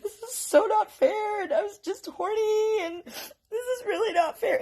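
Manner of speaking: put-on tearful, high-pitched kid protest; mock crying. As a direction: imitate a kid wailing at the injustice. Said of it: "he was just crying".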